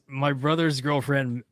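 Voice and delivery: ordinary talk, nothing marked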